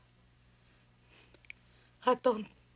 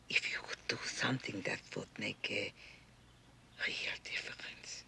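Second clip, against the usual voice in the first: much softer, conspiratorial whisper; whispered urging; 10 semitones lower, 11 dB quieter than usual. Acted. Mother quietly urging her son to use his power. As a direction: lean in and coax him in a whisper.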